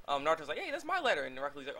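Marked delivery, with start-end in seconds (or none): 0.6-1.2 much higher, indignant take on "my"; high Naruto voice. Retold or imitated. Naruto claiming the torn letter, high and indignant. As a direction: squeak it out indignantly, falling on "my".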